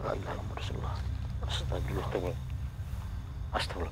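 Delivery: hushed, breathy whisper; frightened whispered dhikr; whispered prayer. Real, not acted. Praying scared at haunted spot.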